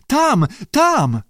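high-pitched cry of alarm; shrieking. A narrator's reading. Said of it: "Lee wrzasnęła".

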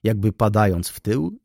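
ordinary delivery, nothing marked

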